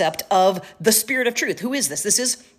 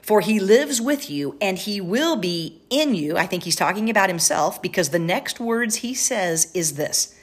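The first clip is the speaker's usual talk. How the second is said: usual speaking voice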